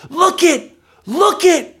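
put-on high, loud child's cry of wonder; excited yell. Daughter in the candy store, excited yell. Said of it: "she begins to yell".

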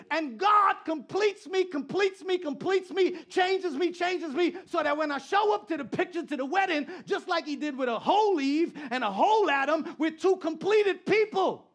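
loud, high preaching cadence; fired-up pastor preaching; preaching at full force. Acted. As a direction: preach it hard to the crowd.

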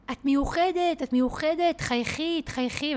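high-pitched mock yell; retold distant shouting. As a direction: imitate someone hollering praise from afar. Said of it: "והוא התחיל לצעוק לי מרחוק".

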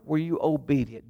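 ordinary talk, nothing marked

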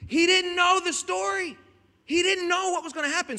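high-pitched, insistent protest; incredulous emphasis. Real, not acted. Preacher insisting to the church he didn't know, incredulous. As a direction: exclaim it high, stretched out in disbelief.

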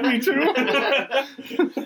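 high, laughing "me too" through tears; laughing admission. Retold or imitated. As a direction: laugh it out, caught crying too. Laughing while admitting crying.

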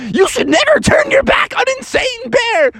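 high, shouted villain boast; gloating villain shout. Acted.

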